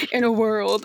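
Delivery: movie trailer voice. Shown as a demonstration. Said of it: "my narrator voice".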